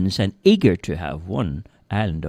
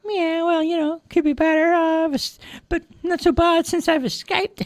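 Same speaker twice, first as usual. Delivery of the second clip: very high, squeaky kitten; high little cat voice, a bit quick. Acted. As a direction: talk like a tiny runaway kitten. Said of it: "said the kitten".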